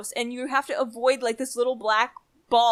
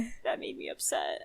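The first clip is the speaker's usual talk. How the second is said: soft whisper, pretending to be wounded; whispered hurt; 9 dB quieter than usual. Real, not acted. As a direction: breathe it out like you're mock-offended. Mock-hurt after a joke.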